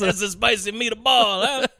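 slow, drawn-out cartoon Italian accent; mock Italian accent. Podcaster mocking the actor's terrible accent, hammed-up Italian. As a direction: lay on a silly Italian accent thickly. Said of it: "He's doing an Italian accent".

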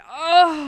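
loud playful groan. Pretend. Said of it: "Just groaning".

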